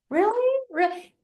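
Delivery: incredulous. Retold or imitated. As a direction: imitate a doubtful viewer's high double take. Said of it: "so simple that you think".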